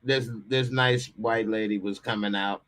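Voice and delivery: ordinary delivery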